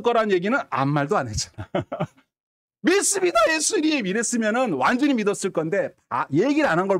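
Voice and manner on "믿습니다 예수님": high-pitched eager profession of faith; fervent believer. Acted. A man professing his belief to Jesus, fervent. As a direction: cry it out as a keen believer.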